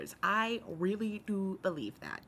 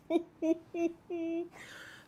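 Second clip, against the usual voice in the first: high embarrassed laugh; 11 semitones higher than usual. Real, not acted. Touched by chat support.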